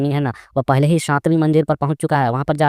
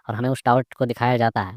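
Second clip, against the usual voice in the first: usual speaking voice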